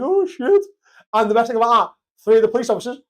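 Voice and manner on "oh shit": mock dismay.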